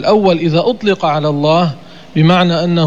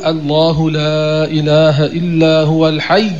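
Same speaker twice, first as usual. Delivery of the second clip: slow recited الحي with a doubled ي; Quran recitation; slower than usual. Shown as a demonstration. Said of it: "تقول". (تلاوة بطيئة مع تشديد الياء)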